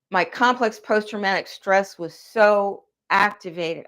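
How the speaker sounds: normal talking voice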